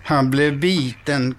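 usual speaking voice

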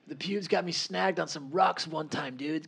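high raspy stoner surfer dude; Steve-O surfer voice. Pretend. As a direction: ham up a burnt-out surfer. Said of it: "surfer voice is Steve-O from Jackass".

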